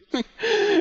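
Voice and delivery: risita muy aguda, casi llorosa; ríe agudo, como quejándose; risa aguda. Real, not acted. Risa tras el susto. (risa aguda tras el susto)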